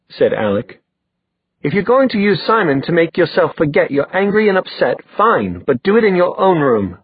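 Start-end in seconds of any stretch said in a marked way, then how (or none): 1.6-7.0 high-pitched exasperated scolding; mildly angry sibling lecture. Acted. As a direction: tell them off, pitch held high. Brother scolds sister in quarrel.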